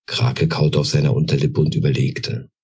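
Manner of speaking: ordinary delivery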